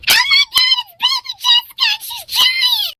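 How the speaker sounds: high-pitched mock scream; retold scream. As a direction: re-enact a panicked scream, high throughout. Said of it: "And I screamed".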